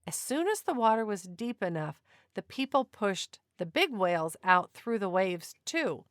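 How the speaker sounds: ordinary delivery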